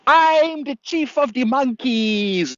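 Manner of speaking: high, slow squeaky monkey voice; monkey chief voice. Retold or imitated. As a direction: squeak it out like a pompous little monkey. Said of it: "like this".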